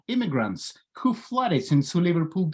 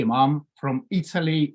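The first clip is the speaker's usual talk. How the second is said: ordinary talk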